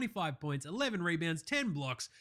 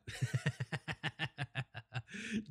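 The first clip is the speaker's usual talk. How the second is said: long staccato chuckle; quick, steady run of giggles; laughing; 6 dB quieter than usual. Real, not acted. Laughs at his own remark.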